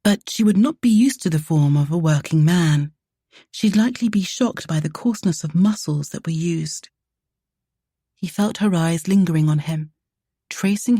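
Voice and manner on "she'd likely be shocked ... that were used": ordinary delivery, nothing marked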